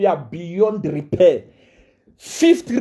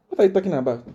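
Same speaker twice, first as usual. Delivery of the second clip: ordinary talk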